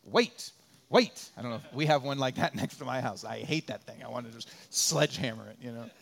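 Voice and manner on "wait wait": loud, robotic crosswalk button voice; loud mock robot repeating its order; crosswalk signal voice. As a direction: mimic the machine at the crosswalk, rising on the last wait. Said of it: "and it says".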